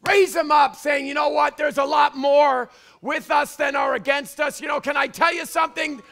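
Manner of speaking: shouted high sermon climax; full-force preaching. Acted. Preacher urging the church to rise, shouting. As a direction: rouse them, building to a roar.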